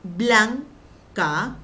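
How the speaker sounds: blanca said loudly as BLAN... CA; split into syllables. Shown as a demonstration. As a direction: punch BLAN in blanca, pause, then a held ca. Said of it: "Aquí puede ser".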